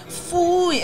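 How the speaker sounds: high, loud mocking "Fooey"; whiny ex impression. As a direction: squeal it like a disgusted ex. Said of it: "my ex was like".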